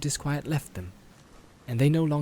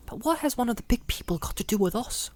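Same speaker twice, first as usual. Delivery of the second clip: put-on high, eager young hobbit; young Pippin voice; 11 semitones higher than usual.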